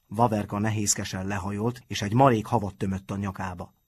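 usual speaking voice

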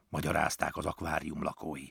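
ordinary delivery, nothing marked

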